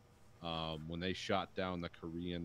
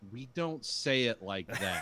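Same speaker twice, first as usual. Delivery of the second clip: slow, louder emphatic objection; mock protest; 4 semitones higher, 5 dB louder, slower than usual. Listener talking back to the audiobook, mock protest. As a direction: protest it slowly, word by word.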